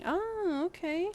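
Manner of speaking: high, amused realisation; an "oh, okay" that jumps up high; surprised oh okay. Real, not acted. Streamer hears chat compare her to Magic card, amused surprise.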